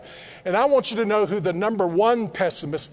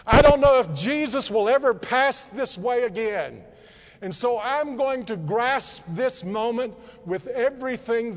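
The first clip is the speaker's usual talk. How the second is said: raised-pitch, emphatic acted plea; louder cry; 4 semitones higher than usual. Bartimaeus calling to passing Jesus, raised urgent cry. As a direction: cry out for mercy, pitched up, seizing the moment. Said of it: "Bartimaeus cried in even a louder voice".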